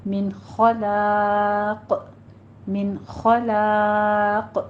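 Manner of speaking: slow خَلَاقٍ ending in an echoing bounced ق; qalqala on qaf. Shown as a demonstration. Teacher shows stopping with qalqala, slow demo. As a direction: bounce the final ق of خَلَاقٍ, slowly. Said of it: "قاف کے اوپر قلقلہ کریں گے".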